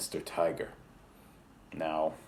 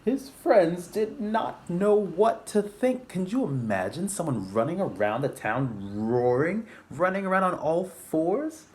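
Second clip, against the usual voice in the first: dramatic narration; 11 semitones higher, 5 dB louder than usual. A narrator's reading. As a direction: read it wide-eyed to kids.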